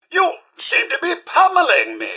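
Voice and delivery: high, loud, affronted protest; startled protest. Acted. Butler protesting at being pummeled, high and indignant. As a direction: raise the voice in alarmed objection.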